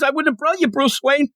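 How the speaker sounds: hammed-up indignation; upset Peacemaker. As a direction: protest like a wounded kid. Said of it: "he's all upset".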